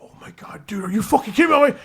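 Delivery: hushed, whispered panic; mock freak-out. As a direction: imitate a friend freaking out under his breath. Pat freaking out in the car.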